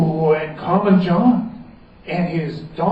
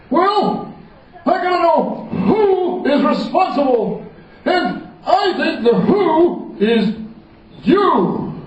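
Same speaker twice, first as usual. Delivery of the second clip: much higher royal accusation; accusing king; 12 semitones higher, slower than usual. Acted. The king accuses the wizard, higher royal voice. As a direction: point the finger at him.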